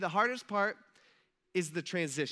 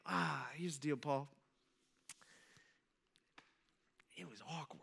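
sheepish mumble; 4 semitones lower, 11 dB quieter than usual. Acted. Lame excuse to Paul in heaven.

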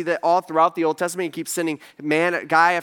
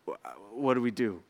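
stunned, lost; 8 semitones lower, 9 dB quieter than usual. Retold or imitated. Stunned crowd, jaws down.

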